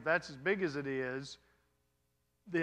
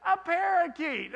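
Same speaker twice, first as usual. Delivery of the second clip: high, loud thrilled squeal; delighted gasp; 12 semitones higher, 6 dB louder than usual. Retold or imitated. Wife spotting a parakeet on the Via Dolorosa, thrilled squeal. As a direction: squeal at the bird in wonder. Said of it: "and it's like".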